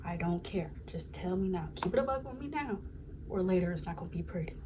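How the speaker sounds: ordinary talk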